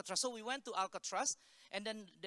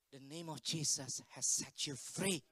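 low, slow, softened declaration; solemn assurance; 6 semitones lower than usual. Retold or imitated. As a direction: say it gently and gravely, taking time. Pastor's hushed answer after her release.

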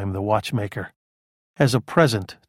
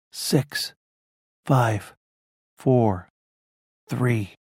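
raspy voice; much slower than usual. A narrator's reading. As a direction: rasp out the count slowly. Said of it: "Howman's raspy voice began the count".

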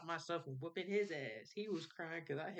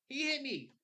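put-on whiny kid; 11 semitones higher, 6 dB louder than usual. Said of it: "but as a kid".

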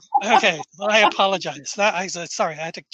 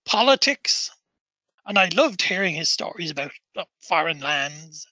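put-on lilting Norfolk-accented old acquaintance, reminiscing. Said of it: "a Norfolk fucking accent".

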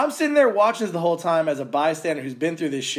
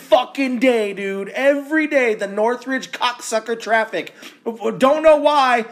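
angry rant; 5 semitones higher than usual. Real, not acted. Host ranting to cohost about traffic, exasperated. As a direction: throw up your hands and vent.

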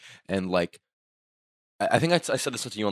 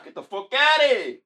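shouting. Real, not acted. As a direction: yell it to scare him off. Shooing the cat away.

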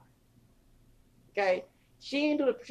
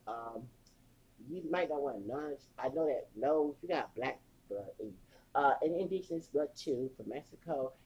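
much softer, small apologetic voice; meek daughter; 8 semitones lower, 15 dB quieter than usual. Retold or imitated. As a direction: say sorry timidly. Donna obeys dad, dumps Rich.

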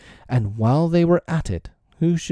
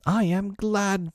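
slow, high-pitched relief; glad cry; 10 semitones higher, slower than usual. A narrator's reading. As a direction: draw it out joyfully, pitched up. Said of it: "Peter cried".

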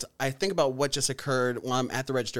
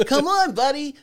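high, louder goading shout; exasperated, pitched-up heckle; mock impatient call; 12 semitones higher, 6 dB louder than usual. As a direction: egg on a dithering driver. Acts out taunting another driver.

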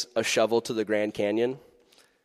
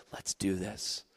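much softer, low and gentle; Jesus kneeling beside us, soft; tender invitation; 5 semitones lower, 10 dB quieter, slower than usual. Retold or imitated. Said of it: "he takes our hand and says".

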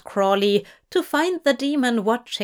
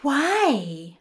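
puzzled; 4 semitones higher than usual. A narrator's reading. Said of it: "Aziraphale almost asked".

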